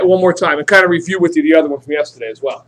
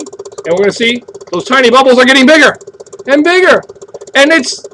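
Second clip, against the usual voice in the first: rising excitement; 6 semitones higher, 4 dB louder than usual. Acted. Teacher building suspense at demo.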